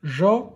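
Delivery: jaune split into "jau", pause, "ne"; syllable by syllable. Shown as a demonstration. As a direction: say jaune as two beats, sounding the final e. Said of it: "à la première syllabe".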